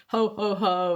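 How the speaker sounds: lightly imitated Santa greeting; a hint of a jolly Santa, not booming; Santa's ho ho ho. Dad as Santa arriving through the front door, lightly voiced jolly Santa. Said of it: "and Santa would come in".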